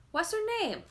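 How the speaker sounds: put-on high, loud small child's question; little boy asking. A narrator's reading. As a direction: ask eagerly like a little boy. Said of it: "mumbled Alyosha".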